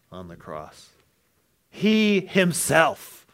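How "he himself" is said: He himself, slow, He pitched high and loud; emphasis on himself. Shown as a demonstration. Preacher stressing He himself. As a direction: lean hard on He himself. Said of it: "It's emphatic in the Greek".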